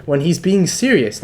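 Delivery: high, loud burst of excitement; acting out a thrilled judge; super excited. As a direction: open on a big "Oh!", then gush. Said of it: "fucking super excited".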